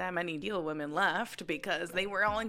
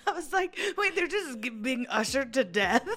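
high, laughing through the words; 7 semitones higher than usual. Real, not acted. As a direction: giggle through the whole line. Laughing at own joke.

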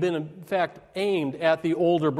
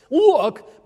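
mock indignant bark; 7 semitones higher, 7 dB louder than usual. Echoing the son's rude first word.

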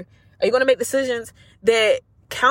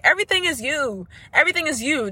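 ordinary delivery

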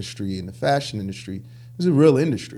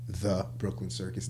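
THE held apart, then a softer Brooklyn Circus; stress on THE; 2 semitones lower, 9 dB quieter than usual. Shown as a demonstration. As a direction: set the word the on its own, name quieter. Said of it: "not just Brooklyn Circus".

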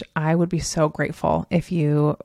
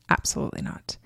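low, soft mock refusal; lightly voiced unbudging kid; imitating refusing son; 10 semitones lower, 8 dB quieter, faster than usual. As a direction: turn Mom down, low and final. Son's refusal of a hug, acted.

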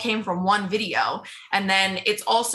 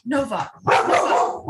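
calling out to a dog; 3 semitones higher, slower than usual. Real, not acted. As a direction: call the dog away, slow. Calling her dog mid-interview.

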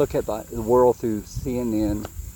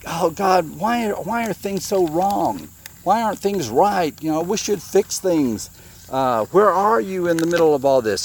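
mock lament, pitched up and pleading; plaintive questioning; 5 semitones higher than usual. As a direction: act out a puzzled complaint to God. Acts out people's complaint to God.

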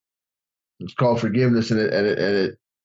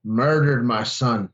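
slow, heavy, deliberate; grave reveal; much slower than usual. Retold or imitated. Speaker revealing his son's killer, slow and grave. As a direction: drop it slowly on the crowd. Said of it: "And he's like".

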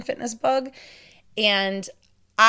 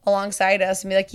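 normal talking voice

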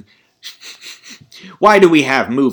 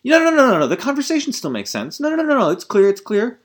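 frantic filmmakers; 11 semitones higher, faster than usual. Retold or imitated. Filmmakers insisting the edited scene still works, in a high frantic mock voice. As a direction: squeal no-no-no like panicked editors defending a cut. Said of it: "they were like".

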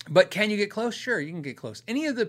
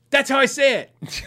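triumphant claim; 9 semitones higher, 9 dB louder than usual. Retold or imitated. Retells friend reading Jaguar card.

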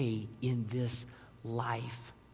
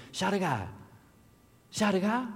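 mock French accent, pitched higher; heavy French accent; 6 semitones higher than usual. French stranger offering help to lost tourists, heavy French accent. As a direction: imitate a Frenchman's thick accent. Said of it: "really rapid French and this heavy accent".

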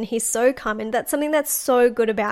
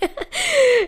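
high bursting laugh; real laugh; 14 semitones higher, 4 dB louder than usual. Real, not acted. Recalling her panic, she breaks into a real high laugh. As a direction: laugh out at the memory. Laughing after partner's reply.

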